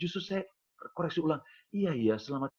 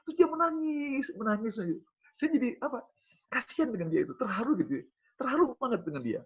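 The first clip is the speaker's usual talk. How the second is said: higher, rising with emotion; preacher moved telling of a man in tears; 11 semitones higher than usual. Real, not acted. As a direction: let the feeling lift the voice.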